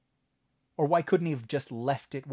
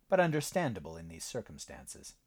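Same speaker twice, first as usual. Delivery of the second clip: plain reading voice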